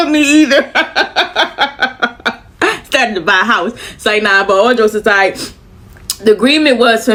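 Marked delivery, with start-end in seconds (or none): 0.7-2.3 softer trailing chuckle; trailing laugh. Real, not acted. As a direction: laugh that dies away softly. Laughs after "me either.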